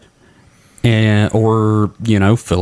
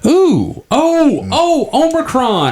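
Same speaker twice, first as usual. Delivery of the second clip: surprised; slower than usual. Real, not acted. Sudden realization of the answer.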